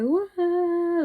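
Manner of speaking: held, high-pitched "wah" wail; imitated scream. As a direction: demonstrate a long hallway scream. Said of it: "started screaming in the hallway".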